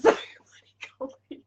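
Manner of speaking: ordinary delivery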